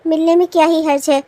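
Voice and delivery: ordinary talk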